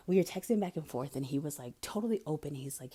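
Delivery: ordinary talk, nothing marked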